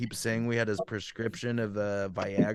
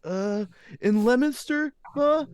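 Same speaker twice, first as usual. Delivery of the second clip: high, slow answer to Mom; pretend kid; 11 semitones higher, 4 dB louder, slower than usual. Prankster naming the town to fake mom, sheepish. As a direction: draw it out as a kid fumbling for the town.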